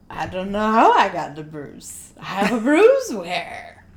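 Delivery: confused tipsy voice; drunk voice. Retold or imitated. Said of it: "the return of [name]'s drunk voice".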